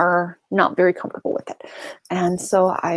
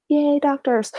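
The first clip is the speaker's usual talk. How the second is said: sarcastic; 8 semitones higher, slower than usual. Real, not acted. Sarcastic aside about doctor appointment.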